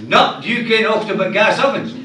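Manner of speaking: high, loud reply while shooting upward; shouting back. Acted. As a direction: yell the punchline back up. Man shouting back mid-air.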